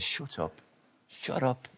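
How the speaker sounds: very soft, mock shushing; hushing crowd. Crowd silencing blind men, hushed mock rebuke. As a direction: silence someone under your breath. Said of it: "And the crowd says".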